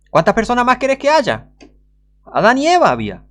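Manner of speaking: high, loud outburst; exasperated shout. Real, not acted. Preacher berating skeptics, high loud exasperated yell. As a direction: yell it in disbelief.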